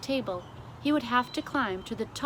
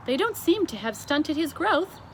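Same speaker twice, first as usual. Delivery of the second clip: Queen dry remark; 8 semitones higher, 4 dB louder than usual. A narrator's reading. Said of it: "the Queen said".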